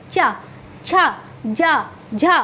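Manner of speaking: चा, छा, जा, झा with tongue to palate; palatal sounds. Shown as a demonstration. Teacher drills the palatal row for learners, चा, छा, जा, झा separately. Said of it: "तालु में".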